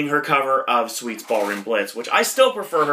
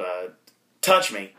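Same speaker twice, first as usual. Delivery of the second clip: ordinary delivery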